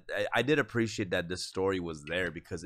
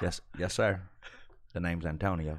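mock audition in a Louisiana accent; Blue Bayou audition; 3 semitones lower, 5 dB quieter than usual. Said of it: "do a little Blue Bayou audition".

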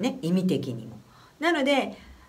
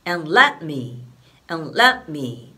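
"and let me" with short clipped "and", a bit louder; 4 semitones lower, 4 dB louder, much slower than usual. Shown as a demonstration. Teacher demonstrating the quick lyric rhythm. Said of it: "って感じですね".

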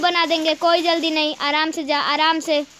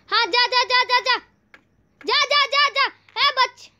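high, insistent egging on; excited urging; 8 semitones higher than usual. Real, not acted. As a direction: cheer him forward, pitched high. Urging the character onward. (जोश से उकसाते हुए)

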